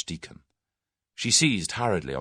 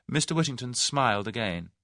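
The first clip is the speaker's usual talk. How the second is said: ordinary delivery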